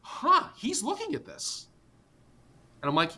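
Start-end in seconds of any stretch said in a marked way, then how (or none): 0.0-1.7 mock puzzled investor. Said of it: "He's like".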